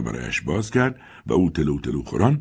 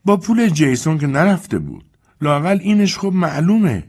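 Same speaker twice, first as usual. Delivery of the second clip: put-on high, raised old servant voice calling upstairs; Dilsey calling up; 11 semitones higher, 5 dB louder than usual. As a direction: call up the stairs to the mistress.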